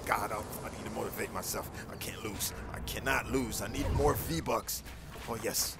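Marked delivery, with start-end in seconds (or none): 0.3-2.5 hushed. Real, not acted. Quietly psyching himself up.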